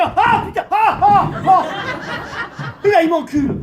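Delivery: very loud, high yelping cries; mock pained yelps. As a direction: howl in comic shock.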